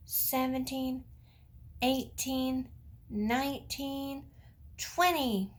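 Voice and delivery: put-on slow, spaced counting for kids; teaching a child to count. Shown as a demonstration. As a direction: count each number slowly for little ones. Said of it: "Can you count with me?".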